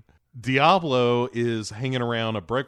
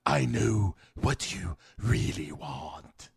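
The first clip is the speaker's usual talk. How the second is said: slow, low seductive mock purr; hammed-up temptress, drawn out; Enchantress voice; 3 semitones lower, slower than usual. Podcaster imitating Enchantress tempting everyone, slow and low. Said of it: "so she says".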